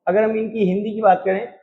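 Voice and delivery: ordinary talk, nothing marked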